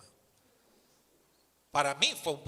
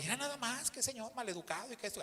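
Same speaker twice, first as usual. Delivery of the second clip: cashier's scolding; 7 semitones higher, 8 dB quieter than usual. Retold or imitated. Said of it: "la cajera volvió".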